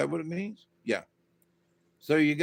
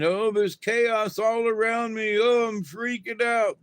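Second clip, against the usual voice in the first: a higher, whiny panicky voice mocking a worrier; half-joking whine of someone freaking out; mock whiner; 6 semitones higher than usual. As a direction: play the fretful complainer, hammed-up. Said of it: "quit whining".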